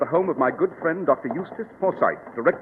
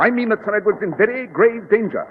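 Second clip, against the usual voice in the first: raised, urgent and emphatic; higher and louder, gravely urgent; urgent warning; 7 semitones higher, 5 dB louder than usual. Acted. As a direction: press the danger home hard. Holmes warns of grave danger.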